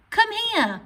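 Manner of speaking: loud high call; calling command. Shown as a demonstration. Teacher voicing a command to show the exclamation mark. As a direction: call a child over. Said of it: "these are all commands".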